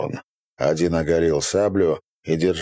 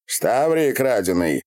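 higher-pitched, pleased question; 5 semitones higher than usual. A narrator's reading. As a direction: ask with a touch of satisfaction. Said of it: "довольно спросил". (довольный вопрос)

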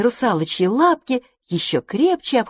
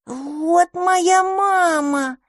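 put-on baby dragon whimper; 12 semitones higher, much slower than usual. Baby dragon clings to its mama.

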